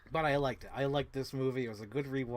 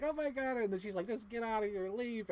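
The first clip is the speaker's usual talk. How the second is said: mock alarm; 8 semitones higher than usual. Host acts out the heroine ordering him to leave, mock alarm. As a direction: imitate her shock in a raised voice.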